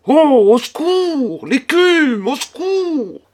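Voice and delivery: high, drawn-out cries for help; loud, high-pitched terror; panicked cry. A narrator's reading. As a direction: wail for help in a theatrical panic. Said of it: "s'écria monsieur Bernard, devenu tout pâle".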